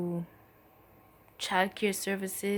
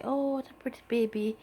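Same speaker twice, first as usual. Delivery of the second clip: lightly voiced cooing lady, a bit higher; admiring ladies; 5 semitones higher than usual. Women in the waiting room admire the baby, light coo. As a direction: coo over the baby like a passing stranger.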